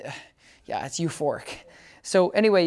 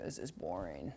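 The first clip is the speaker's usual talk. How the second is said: bored dog; 11 semitones lower, 17 dB quieter than usual. Retold or imitated. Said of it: "He's like".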